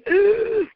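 mock gasp of alarm; sharp, acted intake of shock. Parent hears what the child saw, mock shocked gasp. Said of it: "And you're gonna say".

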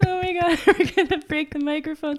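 high squeal through laughter; giggling out of control; hysterical laugh. Real, not acted. Both cracking up.

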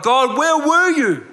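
pleading high loud cry, slow; anguished cry to God. Retold or imitated. Pastor voicing a hurt believer's slow, high anguished cry. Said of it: "you're like".

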